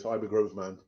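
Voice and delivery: ordinary delivery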